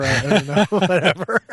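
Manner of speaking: words breaking up into real laughter; laughing. Real, not acted. Speaker admitting a daydream, laughing at himself.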